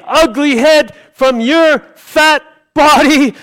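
rising into a full shout at the giant; David's defiant shout. Retold or imitated. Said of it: "David said".

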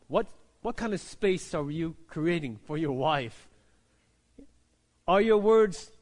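usual speaking voice

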